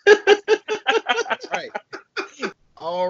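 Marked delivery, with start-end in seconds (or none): 0.1-1.7 laughing. Real, not acted. Laughing start to the segment.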